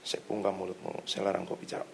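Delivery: very soft, low murmured rebuke; quiet command. Retold or imitated. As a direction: bind it under your breath, barely audible. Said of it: "Saya ngomongnya pelan". (perintah pelan, nyaris berbisik)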